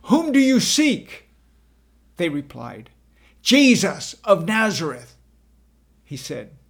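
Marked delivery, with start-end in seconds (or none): 0.0-1.3 loud, high challenge to a crowd; raised, ringing shout; calling out. A narrator's reading. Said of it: "calling out". 3.4-5.2 loud answer from an armed band; forceful, much louder reply; shouted reply. Acted.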